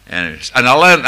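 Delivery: normal talking voice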